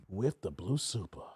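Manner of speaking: low and soft, for effect; deep hushed announcement. Pretend. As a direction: drop to a deep, quiet register. Drops voice to announce super chat.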